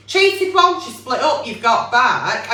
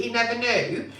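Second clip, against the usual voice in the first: ordinary talk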